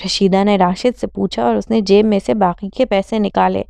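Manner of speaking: ordinary delivery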